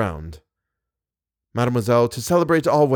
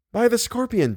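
much higher voice for the heroine; Christine's voice; 11 semitones higher than usual. A narrator's reading. Christine answers the man calling her, high-voiced cry.

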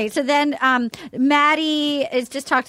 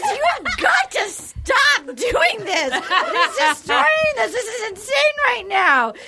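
exasperated son; 6 semitones higher than usual. Retold or imitated. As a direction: beg her to stop, half laughing. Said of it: "And then he goes".

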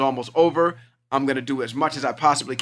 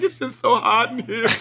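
laughing through a teary excuse; 6 semitones higher than usual. Retold or imitated. Daughter laughing off her crying. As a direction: brush off tears with a laugh. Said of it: "She was like".

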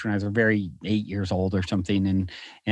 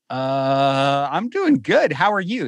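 retold awkward reply, pitched way up; taken aback; 3 dB louder, slower than usual. Retelling his startled reply to stranger.

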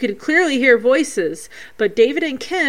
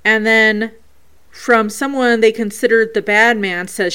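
normal talking voice

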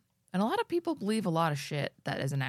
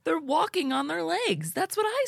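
excited squeal; 12 semitones higher, 5 dB louder than usual. Real, not acted. Mock excited repeat.

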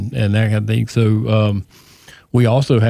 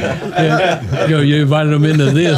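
laughing; 4 semitones higher than usual. Real, not acted. Teasing Kenny about losing church.

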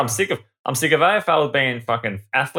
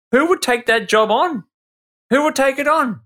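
exasperated; 9 semitones higher than usual. Real, not acted. Exasperated rant about umpiring job.

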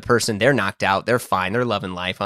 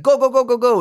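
high, loud mimicked command; imitated frantic shooing; urgent hurrying; 12 semitones higher, 5 dB louder than usual. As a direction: fire it off to rush someone along. Attendant hurrying him out of bathroom.

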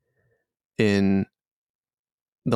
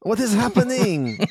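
high-pitched character voice, stretched words; wholly other voice; 12 semitones higher than usual. Acted. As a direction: cry out as a bewildered captive. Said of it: "a wholly other voice in there".

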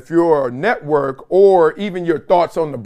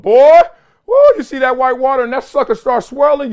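excited; 7 semitones higher, 6 dB louder than usual. Real, not acted. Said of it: "recalling the riptide".

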